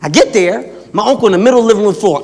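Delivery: ordinary talk, nothing marked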